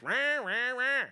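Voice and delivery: high-pitched "rah, rah, rah" nagging; mock nagging noise. Husband mimics his wife on his case, mock nagging. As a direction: imitate a wife yapping on and on. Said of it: "she's always on my case".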